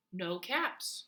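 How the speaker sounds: usual speaking voice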